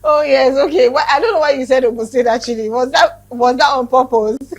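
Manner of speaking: loud laughing teasing question; laughing tease. Real, not acted. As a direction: tease her through laughter. Teasing about Ogun State.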